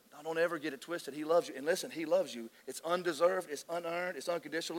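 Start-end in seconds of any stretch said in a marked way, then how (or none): none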